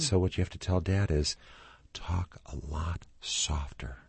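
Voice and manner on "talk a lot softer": soft tone. Shown as a demonstration. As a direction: bring the voice down softly. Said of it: "very soft tone of voice".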